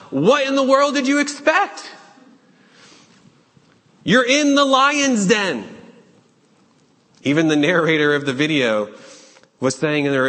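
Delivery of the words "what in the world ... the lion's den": much higher, louder disbelief; as if you can't believe your eyes; exasperated high-pitched disbelief; incredulous thought. Retold or imitated. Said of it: "And I'm thinking to myself".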